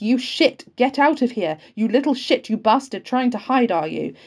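loud, high tirade of abuse; furious scream. A narrator's reading. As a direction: scream it into his face. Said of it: "and scream into his face".

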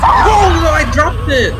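panicked shout. Real, not acted. Drops the rat while chased.

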